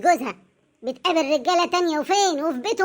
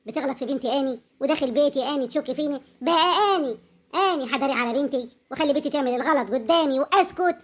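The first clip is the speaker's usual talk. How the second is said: put-on Upper Egyptian accent. Mother speaks Upper Egyptian "آني.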